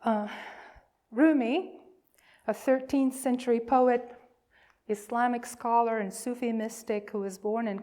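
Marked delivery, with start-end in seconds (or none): none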